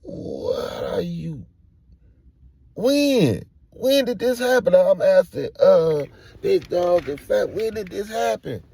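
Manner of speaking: loud, high-pitched demand for answers; stammering start, then alarmed yelling; frantic questioning. Retold or imitated. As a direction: shout the questions in shock.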